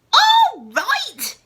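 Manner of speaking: high, loud incredulous yell; loud mocking echo. A narrator's reading. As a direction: yell it back in disbelief. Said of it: "she yelled".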